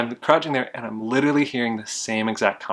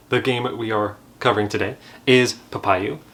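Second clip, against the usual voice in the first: ordinary delivery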